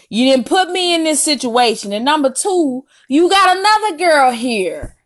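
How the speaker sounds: rising outraged disbelief; exasperated scolding. Retold or imitated. Scolding the boy who lied.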